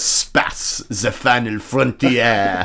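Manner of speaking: put-on breathy, slow French accent taking off Picard; mock French accent barely voiced; whispered mock French accent. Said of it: "he does this thing where he goes".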